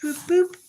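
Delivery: high, bouncy boop boop noises; light sing-song boop; playful boop. Real, not acted. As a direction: make cute blip sounds. Sound effect while writing.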